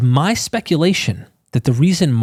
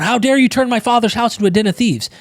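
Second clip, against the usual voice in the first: mild mock outrage; 8 semitones higher than usual. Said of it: "he's going".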